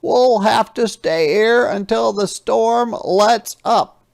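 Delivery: a big friendly truck, sorry and apologetic; Yellow Truck's voice. Acted. Yellow Truck apologises to Little Red in a high, loud voice. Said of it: "said Yellow Truck".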